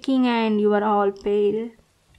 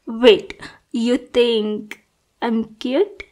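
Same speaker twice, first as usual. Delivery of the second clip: normal talking voice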